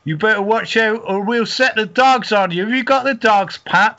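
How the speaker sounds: security guard calling out threats to lads in the hedges, loud; mock security guard shout.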